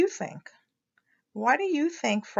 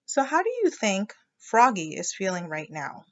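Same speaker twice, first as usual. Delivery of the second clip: ordinary delivery, nothing marked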